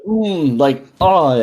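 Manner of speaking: high, loud moans of delight; loud food moans. Retold or imitated. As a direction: moan over the food loudly. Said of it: "make a really loud noise".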